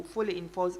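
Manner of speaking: ordinary delivery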